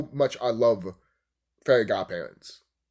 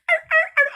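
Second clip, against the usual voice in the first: short high squeal of laughter; 6 dB louder than usual. Real, not acted. Laughing about the cartoon, squealed.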